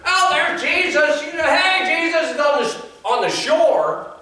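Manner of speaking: high, loud astonished fishermen; excited disciples spotting Jesus. Retold or imitated. Fishermen on the boat calling to each other, amazed. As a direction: call out like men seeing a wonder. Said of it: "if they were".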